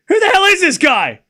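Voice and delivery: high, loud incredulous demand; exasperated outburst. Real, not acted. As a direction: fling the question out, fed up. Streamer exasperated by crowd intruder.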